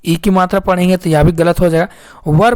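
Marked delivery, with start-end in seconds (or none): 2.3-2.6 वर as one syllable, neutral vowel into r; correct "were" pronunciation. Shown as a demonstration. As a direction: say वर like "wur", no ee or ay. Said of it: "वर पढ़ेंगे".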